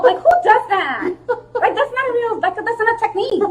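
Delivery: high, incredulous complaint; indignant disbelief. Retold or imitated. Outraged at opponent's straight choke.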